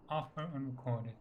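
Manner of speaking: usual speaking voice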